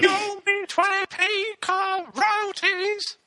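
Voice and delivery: very high, slow robotic falsetto; sing-song auto-tuned robot voice. Pretend. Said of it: "Robin voice".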